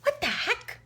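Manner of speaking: put-on very high child's voice, mildly indignant; little niece. Niece waking up and calling her aunt, childlike indignation. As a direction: imitate a small girl's puzzled complaint. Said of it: "and she's like".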